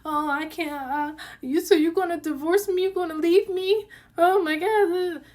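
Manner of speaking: high, whiny mock sob; mock crying. Wife mocking husband's crying when she mentions divorce, high whiny. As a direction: imitate him pretending to cry, high and whiny. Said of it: "He starts crying".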